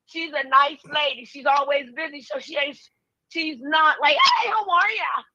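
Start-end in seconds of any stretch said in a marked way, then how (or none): 4.2-5.2 put-on sweet greeting, high and slow; fake friendly. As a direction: sound fake cheerful. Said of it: "with that fake shit".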